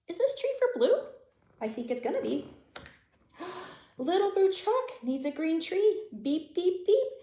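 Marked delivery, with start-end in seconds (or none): 0.1-1.0 high, louder eager question; thrilled wondering; excited question. Real, not acted. 3.4-6.0 surprised happy reading; excited gasp. Real, not acted. Gasp at story climax. 6.2-7.1 high beeping truck horn; playful toy horn beeps. Pretend. Truck horn in picture book.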